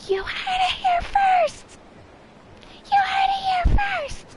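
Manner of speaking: very high, loud, slow shriek; laughing screamed announcement; screaming with every fiber. Retold or imitated. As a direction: scream it out with all you have. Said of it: "with every fiber of my being".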